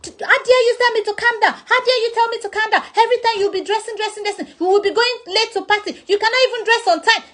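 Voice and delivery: high-pitched mock tirade; hammed-up outrage. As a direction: play the furious friend, over the top. Friend voiced angry outburst.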